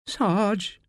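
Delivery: timid whimper, pitch lifted. A narrator's reading. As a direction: whimper it to the sergeant for help. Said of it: "whimpers Pulford".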